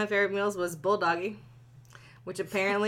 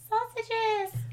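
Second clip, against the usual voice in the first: "Sausages" in a much higher, put-on voice; high mock repeat; 13 semitones higher than usual. Shown as a demonstration. Reader repeats the word in a silly high voice.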